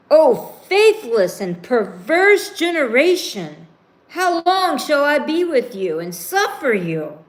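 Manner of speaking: stern rebuke. Acted. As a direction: scold them like a weary prophet. Jesus rebukes the generation.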